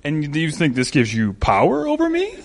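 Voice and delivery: controlled villain voice, rising sharply at the end; low-key menacing villain impression; Bane. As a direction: answer as the cool, taunting movie villain. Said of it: "it's almost like the voice goes".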